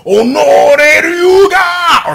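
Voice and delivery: slow, high hammed-up "Oh no"; mock dramatic cry. Fan mocking the villain's turn, hammed-up slow cry. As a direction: play a melodramatic wail.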